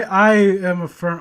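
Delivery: ordinary talk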